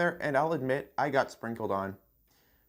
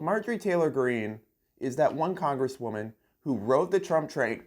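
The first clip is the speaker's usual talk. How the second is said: ordinary talk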